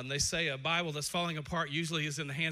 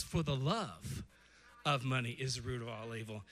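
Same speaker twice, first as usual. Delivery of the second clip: slow, lower, each word weighed; slow correct quote; 7 semitones lower than usual. Shown as a demonstration. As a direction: read the true verse slowly. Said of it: "the Bible says".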